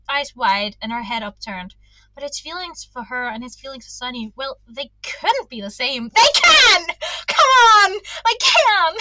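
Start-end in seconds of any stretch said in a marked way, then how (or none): 6.1-8.9 much higher and louder, laughing; pleading protest. Real, not acted. Reader insisting his feelings can be the same, loud laughing protest. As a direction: argue back at the book.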